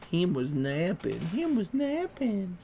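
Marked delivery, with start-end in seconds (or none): 1.2-2.6 put-on higher, slow baby talk. Shown as a demonstration. Owner cooing over his napping dog, high slow baby talk. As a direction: coo it like talking about a baby.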